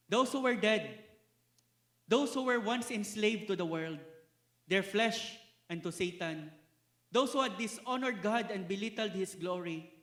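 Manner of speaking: loud, insistent preacher's litany; rising pulpit indictment; forceful preaching. Acted. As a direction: hammer each "those who" home.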